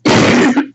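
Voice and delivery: sharp cough; real cough. Real, not acted. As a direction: cough hard. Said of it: "I'm sorry".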